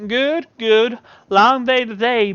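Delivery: high, loud sweet voice; Yolanda's voice. Acted. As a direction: chirp it as Yolanda.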